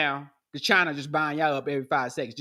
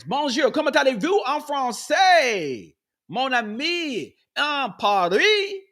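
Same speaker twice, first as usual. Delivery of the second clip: hammed-up French phrases, slow and higher; mock French; 6 semitones higher, slower than usual. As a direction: put on a showy French accent. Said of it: "You like my little French pronunciation?".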